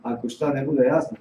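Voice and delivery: normal talking voice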